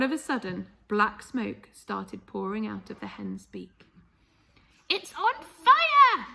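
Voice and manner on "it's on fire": high, loud, slow alarmed yell; a grandmother shrieking in alarm; Grandma yelling. Acted. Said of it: "Grandma yelled".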